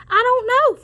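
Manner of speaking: high squeaky voice for Farmer Joe. Acted. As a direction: pitch it way up for the farmer. Farmer answers in character voice.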